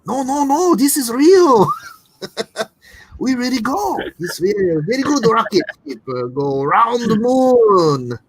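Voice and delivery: high, loud put-on Japanese accent with broken English; playful fake Japanese accent for a Chinese official; bad mock Japanese accent. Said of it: "I'm totally doing a Japanese accent for Chinese".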